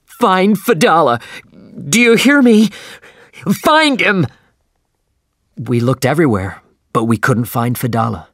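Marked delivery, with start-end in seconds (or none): 0.0-4.3 much higher, louder, strained cry; a captain's voice gone high and strange with panic. A narrator's reading. Ahab to his crew, high strange frantic call. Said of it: "It was high and strange".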